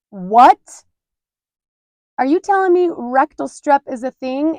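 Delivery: loud, high, incredulous retold cry; shocked disbelief. Mom retelling doctor's strep news, loud shocked disbelief. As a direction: shoot up in outrage at the doctor.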